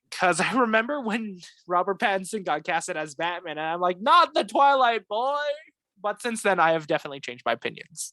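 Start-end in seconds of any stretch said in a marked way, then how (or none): 4.0-5.4 drawn-out, higher-pitched mock protest; mock dismay. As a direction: wail it slowly in playful horror. Retold dismay at the casting.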